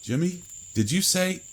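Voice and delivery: a lightly voiced drowsy Lloyd asking; Lloyd half-asleep. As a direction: friend woken, asking who spoke. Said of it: "and asked me".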